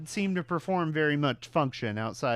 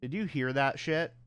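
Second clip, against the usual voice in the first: ordinary talk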